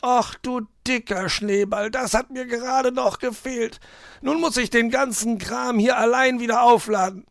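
high-pitched moan, building to loud complaint; groaning, fed-up fox. A narrator's reading. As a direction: moan about being left with the work. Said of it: "stöhnte Herr Fuchs". (stöhnender Fuchs, hoch und klagend)

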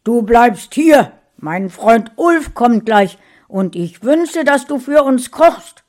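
loud, bossy commanding order; husband ordering his wife to stay and cook; forceful. Acted. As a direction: lay down the law harshly. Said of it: "sagte Gustav energisch".

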